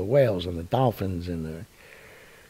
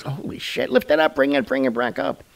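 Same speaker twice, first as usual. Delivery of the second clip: mock panic; 4 semitones higher than usual. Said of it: "everybody's like".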